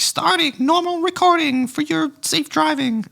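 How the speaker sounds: high, slow robot voice; flat robot announcement; robotic voice. Retold or imitated. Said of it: "this robotic voice comes on and goes".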